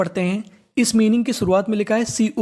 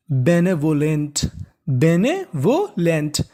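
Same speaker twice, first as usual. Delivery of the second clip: slow spelled-out; 4 semitones lower, much slower than usual. Shown as a demonstration.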